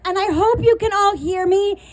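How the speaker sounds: normal talking voice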